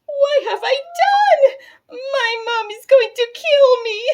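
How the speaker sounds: put-on high, loud panicked child voice; panicked shout. A narrator's reading. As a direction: shriek it out in horror at yourself. Bridget panics at the mirror.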